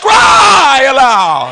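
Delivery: loud retort swelling into a long cry; shouted preaching. Shown as a demonstration. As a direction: shout back at the doubters, stretching the last words. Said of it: "eh, bakit isisigaw pa?".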